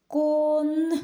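"Кон" stretched, each sound held apart; sounding out syllables. Shown as a demonstration. Teacher sounding out the word for the class, drawn out. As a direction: build "Кон" sound by sound, slowly. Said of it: "давайте зробимо звуковий аналіз".

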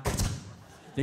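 crisp voiceless shutter click; imitated camera shutter. Snapping photos on the phone, imitated shutter. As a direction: click like a camera taking a snap.

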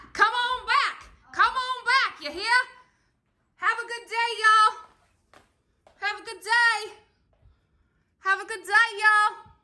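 high, loud hammed-up holler; nerdy, goofy sign-off. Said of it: "I just get nerdy and geeky".

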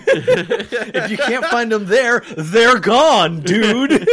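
slow and loud, laughing at the customer; snarky retort. Retold or imitated. Retold snarky reply to customer.

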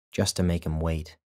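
ordinary delivery

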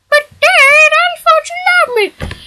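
put-on high childish son voice pleading; whiny son. Son begs his dad for love, high and whiny. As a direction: play the hurt kid begging Dad.

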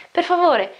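usual speaking voice

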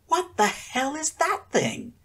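high, slow, squeaky voice. A narrator's reading. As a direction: squeak it out in alarm. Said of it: "Akira squeaked".

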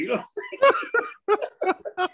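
drawn out through laughter; laughing. Real, not acted. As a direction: stretch it out laughing. Laughing hard.

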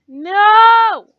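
loud, high cry of defeat; frustrated shout. Real, not acted. Player after yet another missed hit, loud frustrated shout. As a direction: yell it in frustration. Attack misses again in game.

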